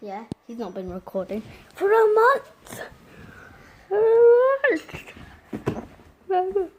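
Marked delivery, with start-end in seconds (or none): 3.9-6.7 high, loud outraged squeal; shocked shriek. Real, not acted. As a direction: shriek it in disbelief. Outraged repeat at friend.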